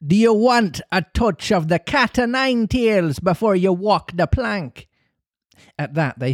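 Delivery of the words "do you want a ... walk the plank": high, taunting pirate threat; crying pirate captain. Acted. Pirate captain to his prisoners before the plank, crying out high. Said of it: "he cried".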